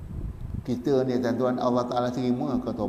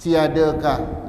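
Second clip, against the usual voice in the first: louder, slow, pleading prophet; Prophet Daud pleading; 3 semitones higher, 3 dB louder, slower than usual. Acted. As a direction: plead to God with the voice raised. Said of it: "Nabi Daud alaihi salam". (rayuan Nabi Daud yang lantang dan perlahan)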